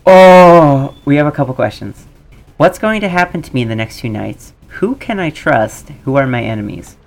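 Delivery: ordinary talk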